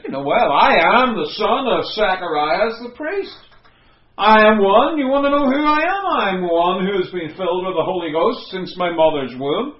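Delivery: loud, high self-important declaration; boastful answer. Retold or imitated. John imagined bragging to the priests, loud and proud. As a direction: puff up and proclaim yourself. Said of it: "might have answered them truthfully and said".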